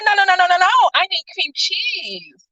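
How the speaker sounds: high-pitched retold refusal; indignant protest. New Yorker retells objecting to a skimpy bagel, indignant.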